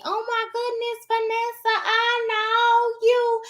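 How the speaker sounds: high-pitched, sing-song fan greeting; excited fan. Acted. Fan spots the vlogger in public, high sing-song excitement. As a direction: squeal it high, stretching the last words. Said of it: "for you guys to tell me".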